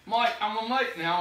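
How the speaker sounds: playful attempt at English accent; mock English accent. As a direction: have a go at an English mate. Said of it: "That's an English accent".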